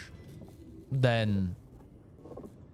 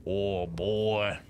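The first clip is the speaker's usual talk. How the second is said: mock American version; slower than usual. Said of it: "if I wanted to be my American version".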